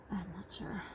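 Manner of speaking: breathy whisper to herself; whispered aside. Real, not acted. Whispering to the viewers, unsure of the count.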